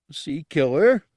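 loud, high, snuffling question; uncertain snuffle. Acted. The guard asks the humans about the killer, snuffling uncertainly. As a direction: sniff the question out, unsure. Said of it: "Gartog snuffled uncertainly".

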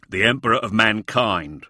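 loud, weighty comeback; forceful military put-down; soldier's retort. A narrator's reading. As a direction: throw it back loudly, pulling rank. Hussar's contemptuous retort to challenge.

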